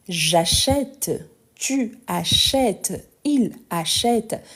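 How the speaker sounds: "achète" drawn out slowly with an open "eh"; open è sound. Shown as a demonstration. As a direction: stretch the è of "achète" wide open, word by word. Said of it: "accent grave qui vient justement pour ouvrir les sons". (« achète » lentement, avec un è bien ouvert)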